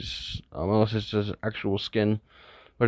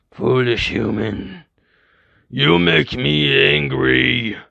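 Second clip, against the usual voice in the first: slow menacing zombie voice, getting louder; angry monster; 3 semitones higher, 5 dB louder, much slower than usual. Acted. Zombie glaring at Izuku, slow rising monster anger. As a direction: threaten them, each word dragged out.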